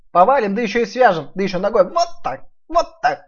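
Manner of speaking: high loud boastful piglet; boasting piglet. Acted. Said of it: "расхвастался Ниф-Ниф".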